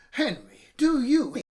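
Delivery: high loud accusing question; suspicious mum. Acted. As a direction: mum suspects nits.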